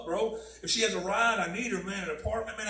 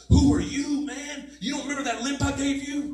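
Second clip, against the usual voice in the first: higher, louder voice of God rebuking; a God who calls him out; God confronting the judging man, higher and louder; God's voice; 6 semitones higher, 4 dB louder than usual. Retold or imitated. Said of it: "And God said".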